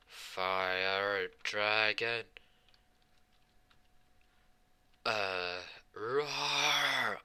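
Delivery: drawn-out attack yell. Acted. The two kids yell their roar at Gildas, slow hammed-up battle cry. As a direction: stretch out the move name, then let the roar jump up.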